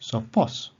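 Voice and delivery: "Sopos" stressed on POS, rising; stress on last syllable. Shown as a demonstration. Teacher showing ultima accent, "Sopos" stressed on POS. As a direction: lift the final POS of "Sopos". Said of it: "the accent is upon the ultima".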